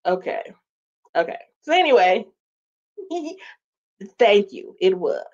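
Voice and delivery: ordinary talk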